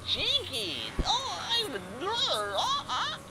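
very high, squeaky cartoon voice; Velma. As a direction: exclaim like a flustered cartoon nerd. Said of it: "what did Velma say?".

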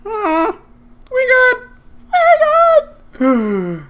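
very high, loud falsetto; giddy squeal. Real, not acted. Gamer reassuring themself after the fight, giddy squeal. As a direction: squeak it out, stretching the last one.

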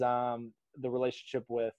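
usual speaking voice

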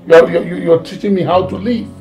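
heated, incredulous scolding; indignant father. Acted. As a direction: shout it, affronted at being lectured. Father rages at questioning children.

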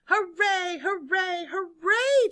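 high, building hurrahs; cheering. A narrator's reading. Crowd celebrates the seesaw moving, cheering. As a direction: cheer each one, biggest on the last. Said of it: "cheered the crowd".

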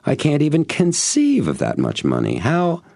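high-pitched, flustered protest; exasperated snap. A narrator's reading. Buyer overwhelmed by the price, exasperated. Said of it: "I snapped".

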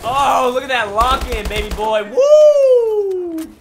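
loud cheer rising into a high whoop; excited hollering. Real, not acted. Gamer cheering a kill, excited holler.

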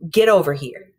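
loud, sudden summons; stern mama. Acted. Teacher imitating mama's tone to explain inflection, loud stern command. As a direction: call it out like a mother giving an order. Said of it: "that thing where mama might ... that tone".

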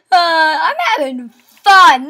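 ordinary talk, nothing marked

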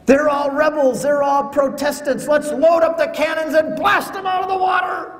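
loud, high call to load the cannons; rally the crew to blast them; mock battle cry. Convert urging the captain to fire on Protestants, loud mock fury. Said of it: "This isn't what I say".

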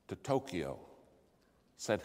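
ordinary delivery

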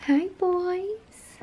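high, soft pet-talk greeting; cooing to the cats. Real, not acted. To her cats on the bed, a high soft coo. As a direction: coo hello to your kitties.

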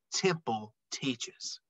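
hushed. A narrator's reading. Reverent pause in story.

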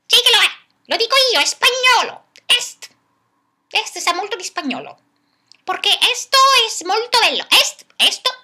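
high, loud, fast stubborn insistence; stubborn puppet. Acted. Puppet insists to Zupla that Latin is Spanish, high and stubborn. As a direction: insist like a know-it-all diva.

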